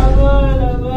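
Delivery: loud theatrical wail. Acted. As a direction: cry out to your mother on the phone. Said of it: "ఏడవకు నువ్వు".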